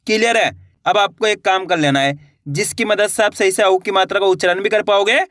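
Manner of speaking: normal talking voice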